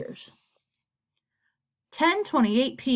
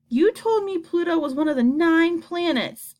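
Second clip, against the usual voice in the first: yelled; 7 semitones higher than usual. A narrator's reading. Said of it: "Augie yelled at Isabel".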